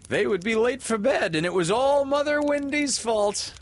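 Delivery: high, loud, cheeky glee; gleeful boys. A narrator's reading. Boys rowing home, high and merry. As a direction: toss it off like giggling boys.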